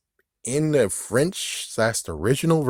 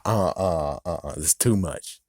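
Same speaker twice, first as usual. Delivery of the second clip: ordinary talk, nothing marked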